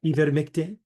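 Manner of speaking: ivermectin with a French accent, ee-bed-mek-ti; mock French ivermectin; playful French accent on ivermectin. Shown as a demonstration. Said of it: "We could do French".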